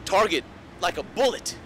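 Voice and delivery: high, forceful declaiming that builds to a drawn-out shout; impassioned war-cry tirade. Acted. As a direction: build from fierce intensity to a full shout. Impassioned monologue building to climax.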